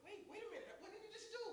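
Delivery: high-pitched comic disbelief; hammed-up, high double take; mock bafflement. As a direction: play up being completely thrown. Retold bafflement at the singer's reply.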